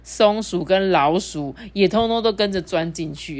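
ordinary delivery, nothing marked

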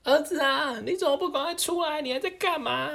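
lightly voiced anxious mother; mother calling out. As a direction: call the son out of the fire. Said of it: "他就赶快大喊说".

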